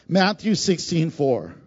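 ordinary talk, nothing marked

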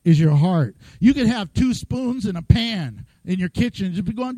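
usual speaking voice

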